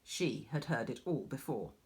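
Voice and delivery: ordinary delivery, nothing marked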